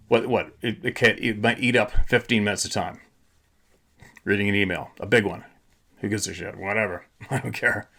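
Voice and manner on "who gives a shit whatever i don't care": quiet dismissal. Real, not acted. Dismissive muttering.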